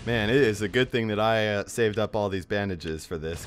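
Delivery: ordinary talk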